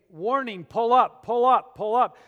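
loud, repeated cockpit alarm call; computerized warning voice. Retold or imitated. Preacher imitates the cockpit alarm before a crash, computerized warning voice. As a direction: lightly imitate an automated warning, punching each repeat. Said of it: "a computerized voice that started saying".

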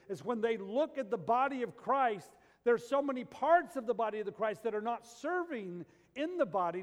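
impassioned preacher voice; preaching at full force. Acted. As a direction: drive it home to the pews.

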